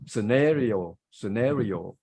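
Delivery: "scenario" as suh-NAIR-ee-oh; American-style "scenario", said twice with NAIR stressed; American pronunciation. Shown as a demonstration. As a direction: give "scenario" an "air" in its stressed second syllable. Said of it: "If you like American accent".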